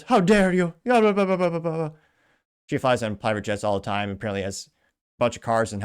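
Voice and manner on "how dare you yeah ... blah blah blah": mock outraged girl, high and loud; mocking Greta quote. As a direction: parody her outrage, high and shrill-loud. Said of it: "the girl that goes".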